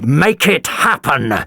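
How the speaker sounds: slow, emphatic command; thundering order. A narrator's reading. Doctor thunders at the commodore, slow and firm. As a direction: lay down the law to Kelper. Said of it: "the doctor thundered".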